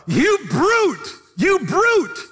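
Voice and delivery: high, loud outraged heckle; indignant yell. Retold or imitated. A man in the audience to Wigglesworth, an outraged yell. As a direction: shout him down from the crowd. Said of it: "One guy yells out".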